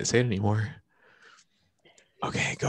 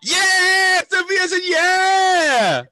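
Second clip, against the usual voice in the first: excited shout; 3 dB louder, much slower than usual. Real, not acted. Teacher cheers student's answer.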